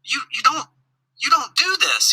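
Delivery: high, pleading scold of a frustrated dad; frustrated pitch-up at the toddler; exasperated. Retold or imitated. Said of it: "And I'm like".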